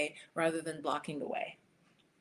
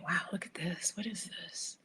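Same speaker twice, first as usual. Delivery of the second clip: soft unvoiced whisper, much softer; whispering to herself; 8 dB quieter than usual. Real, not acted. Tarot reader whispers to herself while fanning the cards. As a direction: hush it like a private ritual. Whispers over drawn card.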